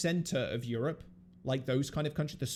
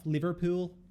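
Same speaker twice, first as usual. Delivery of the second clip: higher-pitched Liverpudlian accent; 5 semitones higher than usual. Shown as a demonstration. English friend demonstrating northern accents, Liverpudlian accent. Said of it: "That's a Liverpudlian accent".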